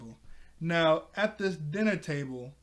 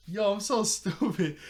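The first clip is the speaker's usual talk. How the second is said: higher, louder laughing self-reproach; 5 semitones higher, 5 dB louder than usual. Real, not acted. Kicking themself for forgetting to mention the main part, laughing. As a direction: blurt it out through a real laugh. Laughing at own forgotten detail.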